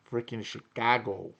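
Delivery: put-on Chicago accent. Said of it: "Oh, the Chicago accent".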